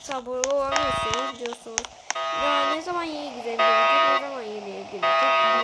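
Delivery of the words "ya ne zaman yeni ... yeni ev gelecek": louder, pushy impatient question; impatient fans asking. Retold or imitated. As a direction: nag like an impatient fan. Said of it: "diye sorular var".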